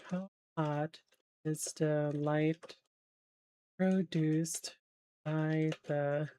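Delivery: slow, halting, word by word; typing aloud. Real, not acted. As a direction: say it slowly as you type a search. Sounding out a typed search query.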